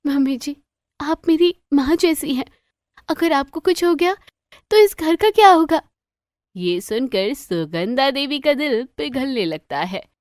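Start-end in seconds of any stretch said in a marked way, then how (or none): 0.1-5.8 higher, rising to louder, faster plea; earnest daughter-in-law. A narrator's reading. Bride tells sick mother-in-law she is like a mother, earnest. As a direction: plead with growing emotion.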